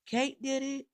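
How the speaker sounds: high, loud full-voiced yell. A narrator's reading. D W heard over the spinning grave, yelling. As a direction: yell it out at the top of your voice. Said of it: "I could hear D W yelling".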